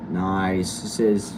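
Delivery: ordinary talk, nothing marked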